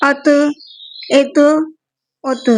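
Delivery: "ات" with a strongly echoing ت; "ات" with a clear bounce on ت; "ات" with the ت released as an echo; strong qalqalah. Shown as a demonstration. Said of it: "ہم ان میں قلقلہ خوب ظاہر کریں گے".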